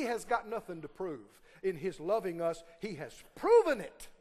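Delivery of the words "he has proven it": ordinary talk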